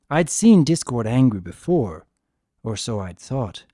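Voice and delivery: plain reading voice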